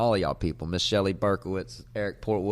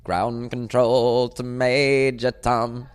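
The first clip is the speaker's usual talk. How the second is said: slow, drawn-out spacey radio voice; mock radio call; 7 dB louder, much slower than usual. Host riffing on the pilot episode, slow mock mission-control call. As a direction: stretch it out like a transmission into space.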